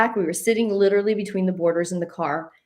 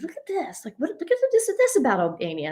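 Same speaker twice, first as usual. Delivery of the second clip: high, fast, eager 'look at this'; excited quote; 6 semitones higher, faster than usual. Retold or imitated. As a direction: imitate him pushing the facts at you, high and quick. Husband eagerly showing research.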